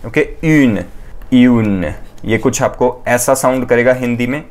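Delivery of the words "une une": une said as French une with rounded lips; slow model une for learners. Shown as a demonstration. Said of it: "this will be pronounced as une". (French उच्चारण में une)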